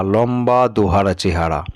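ordinary delivery, nothing marked

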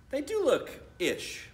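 high, slow amazed voice; dawning wonder. Acted. Boy seeing his sister's gallery anew, high slow wonder. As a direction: let the realization sink in slowly.

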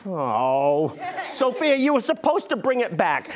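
ordinary talk, nothing marked

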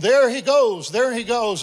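high, loud crowd shout, repeated. Retold or imitated. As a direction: call him out to the whole crowd. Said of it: "And someone screamed".

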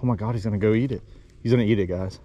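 ordinary delivery

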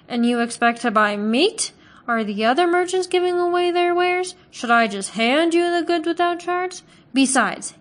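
mock him for wanting it free; scornful butcher. A narrator's reading.

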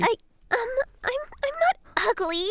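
high, halting tearful protest; crying. Acted. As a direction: stammer it through tears, breaking into sobs. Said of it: "You made her cry".

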